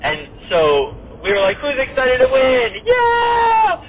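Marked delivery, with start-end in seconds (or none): 1.6-3.8 high, loud team cheer; rally them, then whoop high; excited yell. Retold or imitated. Team hyping up before the fight, excited yell. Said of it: "We're all just yelling".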